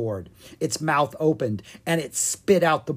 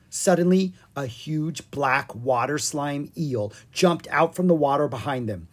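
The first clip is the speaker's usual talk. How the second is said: plain reading voice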